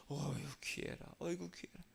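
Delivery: soft, fond cooing; tender doting. Acted. Preacher acting out God treasuring a person, soft doting. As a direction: coo over someone precious, very softly.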